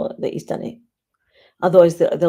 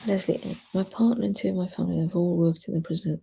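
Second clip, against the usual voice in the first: ordinary delivery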